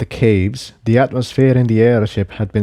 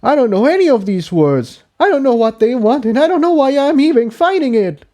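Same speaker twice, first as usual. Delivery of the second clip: higher, rising toward despair; higher colt voice, despairing; Jigsaw, frustrated outburst; 14 semitones higher, faster than usual. Acted. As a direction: build it up in frustration.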